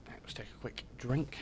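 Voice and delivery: quick real whispered aside; whispered aside to chat before a sip. Real, not acted. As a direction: breathe it out without voice, just for yourself. Aside before drinking on stream.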